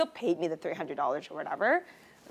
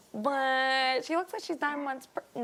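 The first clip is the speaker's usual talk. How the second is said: incredulous; 5 semitones higher, slower than usual. Retold or imitated. Retold disbelief, high slow.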